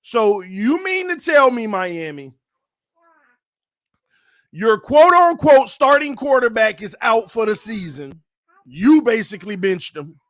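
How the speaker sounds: loud, incredulous sports rant; indignant. Real, not acted. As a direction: call the team out, a little upset. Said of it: "the one that makes me mad, a little upset".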